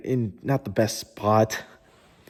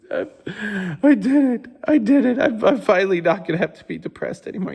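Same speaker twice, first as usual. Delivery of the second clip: mock tearful triumph; 7 semitones higher than usual. Retelling his breakthrough at the event, mock tearful triumph. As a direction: imitate blubbering victory, voice up high. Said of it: "crying at Tony Robbins".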